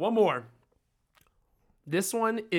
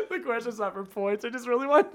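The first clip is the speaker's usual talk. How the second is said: laughing while talking; 8 semitones higher than usual. Real, not acted. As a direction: explain it while cracking up. Cracking up at own trick question.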